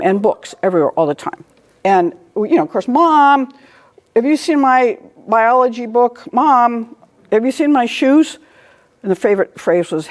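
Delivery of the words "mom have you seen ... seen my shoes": put-on kid calling mom. Kids calling for lost things.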